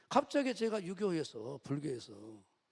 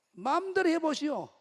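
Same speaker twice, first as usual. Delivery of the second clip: raised, high defiant retort; loud, high "do as you please" defiance; defiant challenge; 9 semitones higher, 6 dB louder than usual. Retold or imitated. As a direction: act out throwing down a challenge. Retells his defiant challenge to God.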